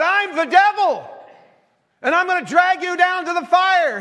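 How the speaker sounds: high, loud, hammed-up threat; menacing devil act. Wife in devil costume threatens husband, loud mock menace. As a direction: play the devil, shouting to terrify him.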